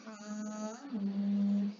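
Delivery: hamim drawn out slowly; elongated recitation. Shown as a demonstration. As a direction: softly hold each syllable of hamim. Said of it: "pada bacaan hamim".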